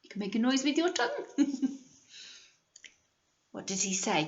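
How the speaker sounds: ordinary delivery, nothing marked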